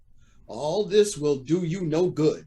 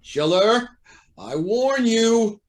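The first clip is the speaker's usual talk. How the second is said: loud, slow, rising warning; stern warning; 6 semitones higher, 5 dB louder, slower than usual. Acted. Judge warning the lawyer who threatens him, stern. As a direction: draw out the warning with mounting anger.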